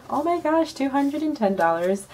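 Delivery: stunned by how much money; excited surprise. Real, not acted. Surprised by envelope total.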